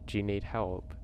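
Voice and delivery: ordinary delivery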